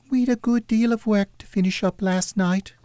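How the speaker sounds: high falsetto daughter voice; girl replying. A narrator's reading. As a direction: explain yourself as a young girl, pitched high. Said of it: "replied the girl".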